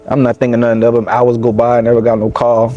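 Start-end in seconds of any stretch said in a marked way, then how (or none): none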